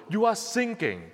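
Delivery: ordinary talk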